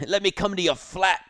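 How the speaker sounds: high, loud hammed-up British voice; mock British accent. A buddy asks to visit his cohost's flat in a silly British accent. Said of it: "Who invited the British guy".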